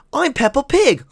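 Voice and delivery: high little-girl cartoon pig voice; Peppa Pig voice. Shown as a demonstration. Doing the cartoon pig to show the viewers. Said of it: "That's about how it sounds".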